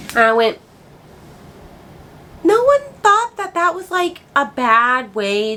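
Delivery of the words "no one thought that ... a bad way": high-pitched disbelief; incredulous. Real, not acted. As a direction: question it as if appalled nobody noticed. Incredulous at offensive wording.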